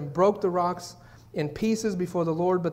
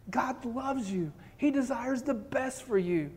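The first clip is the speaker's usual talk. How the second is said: tender reassurance; 6 semitones higher, slower than usual. Real, not acted. Pastor reassuring congregation.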